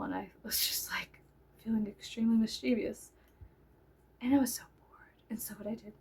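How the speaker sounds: whispered mischievous aside. Real, not acted. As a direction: lean in and whisper the confession.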